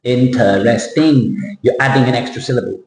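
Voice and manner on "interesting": "Interesting" said slowly, three syllables, no schwa; slow model word. Shown as a demonstration. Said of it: "there's no schwa sound".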